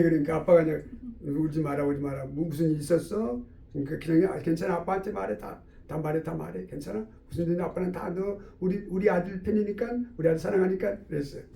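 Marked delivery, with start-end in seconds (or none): 1.2-11.0 soft, lowered comforting voice; soothing father. Acted. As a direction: hush a crying child tenderly. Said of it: "막 통곡하면서 울기 시작하는 거예요". (우는 아이를 나직이 달래듯)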